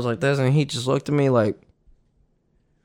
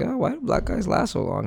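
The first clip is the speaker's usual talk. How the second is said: put-on higher, softer girl asking; girl's voice; 8 semitones higher, 7 dB quieter than usual. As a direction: play her, impressed. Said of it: "she was like".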